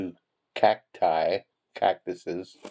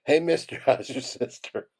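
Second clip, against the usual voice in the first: higher-pitched calling out, breaking into laughter; mock yell; 6 semitones higher than usual. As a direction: call out as the little cactus, laughing midway. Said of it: "the little cacti yells out".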